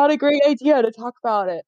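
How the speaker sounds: talking through a yawn. Real, not acted. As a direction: say it mid-yawn. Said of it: "As you're yawning".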